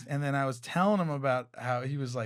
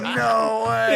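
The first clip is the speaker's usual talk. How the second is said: louder mock yell of disbelief; mock shout; 6 semitones higher, 7 dB louder than usual. Friend spots the girl from the story, mock disbelieving shout. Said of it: "He goes".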